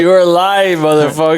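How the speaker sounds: loud, high-pitched disbelief; real incredulous exclamation; incredulous outburst. Real, not acted. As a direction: call out your friend's tall tale, voice jumping up. Incredulous reaction to friend's claim.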